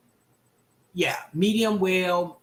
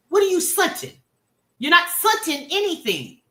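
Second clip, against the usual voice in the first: mocking scorn; 9 semitones higher, 3 dB louder than usual. Real, not acted. Ridiculing wrong verb.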